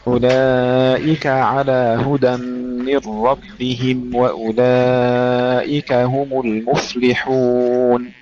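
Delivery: أولئك with the kaf opened, not breathed; slow, steady model of the whole verse; voiced open kaf. Shown as a demonstration. Teacher models the verse for Jamila, very slow. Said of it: "افتحي الكاف ولا تهمسيها".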